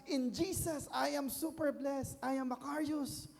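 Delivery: high, emotive imitation of a believer; declaring with feeling. Imitating believers who declare blessings, high emotional declaration. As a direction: play it heartfelt, trembling with fervour. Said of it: "yung may feelings pa".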